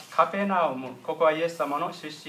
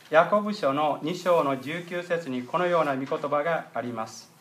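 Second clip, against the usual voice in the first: normal talking voice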